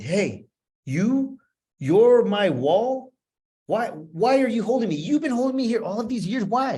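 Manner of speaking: high, loud confrontation, slow at first; rising anger at the inner child; angry at his child self. Retold or imitated. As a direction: demand answers from the boy who held you back. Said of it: "I got angry with this little boy".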